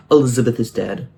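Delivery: normal talking voice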